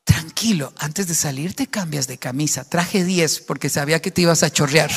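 soft, reassuring comfort to a husband; gently calm him down, no reproach; tender wife. Pretend. Elderly wife soothes husband who spilled food, softly tender. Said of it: "Diferente sería es".

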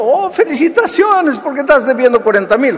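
husband mockingly congratulating; sarcastic congratulations. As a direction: sneer the congratulations ironically.

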